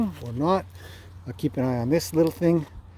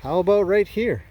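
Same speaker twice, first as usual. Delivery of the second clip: lightly voiced horse, pitched higher; higher-pitched suggestion in a horse's imagined words; voicing a pushy horse; 5 semitones higher than usual. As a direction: playfully speak for a horse, pitch lifted. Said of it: "run into you saying".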